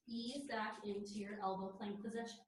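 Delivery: ordinary talk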